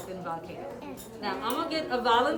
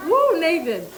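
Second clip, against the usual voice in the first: cheering; 5 semitones higher, 9 dB louder, slower than usual. Real, not acted. Cheering the student.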